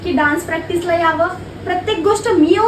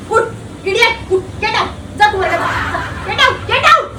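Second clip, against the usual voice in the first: louder, higher shouts of get out through a laugh; angry yelling; 4 semitones higher, 6 dB louder than usual. Acted. Teacher angrily orders a student out of class. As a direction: throw the student out, half laughing.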